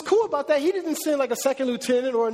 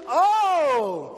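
mock surprised oh; 5 semitones higher than usual. Peter realizing.

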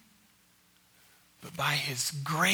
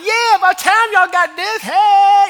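loud, high gloating shout; hammed-up party hollering; mock triumphant cheer; 14 semitones higher, 17 dB louder, slower than usual. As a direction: whoop it up, drawing out the last cry. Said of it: "that's not Jesus' disposition".

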